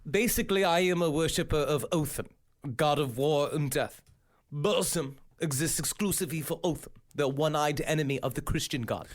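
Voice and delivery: low, halting quote voice. Acted. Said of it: "[name] has been quoted as saying".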